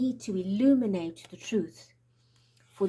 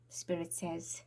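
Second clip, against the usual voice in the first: whispering; 2 semitones lower, 8 dB quieter than usual. Real, not acted. Relaying spirit message.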